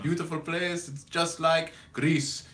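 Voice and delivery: hammed-up old Greek man calling; Greek accent. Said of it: "in his very Greek accent".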